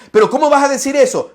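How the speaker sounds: high, shocked retort; outraged Pharisee. Acted. Pharisee retorting to Jesus, outraged high protest.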